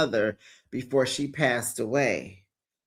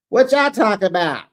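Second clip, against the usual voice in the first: voicing Wanda; 6 semitones higher, 5 dB louder than usual.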